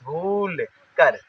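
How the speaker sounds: भूल कर slow, "bhoool" stretched out; long held u. Shown as a demonstration. Teacher demonstrating the long vowel sign, drawn out. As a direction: draw out the vowel of भूल, then कर. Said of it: "यहाँ पे हम देर तक रुकेंगे".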